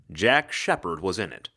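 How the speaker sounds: ordinary delivery, nothing marked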